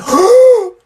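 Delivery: loud, high gasp for air; sharp gasp. Real, not acted. Out of breath after rapping, gasping loud. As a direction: gasp like you just sprinted.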